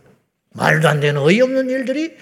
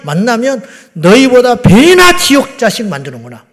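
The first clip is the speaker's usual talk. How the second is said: loud, raised pulpit denunciation; preached rebuke; 4 semitones higher, 6 dB louder than usual. Acted. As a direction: proclaim the verse forcefully. Quoting Jesus' rebuke to Pharisees.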